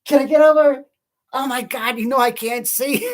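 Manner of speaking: high-pitched mock scream of a panicked mom. Mom driving kids, yelling to change lanes, mock screaming. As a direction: shriek it like a frantic mom driving. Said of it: "she'd be screaming over".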